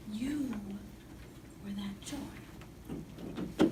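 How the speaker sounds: very slow, breathy whisper of the same line. Real, not acted. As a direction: let it fall to a hush, drawing out every word. Repeated as a whisper.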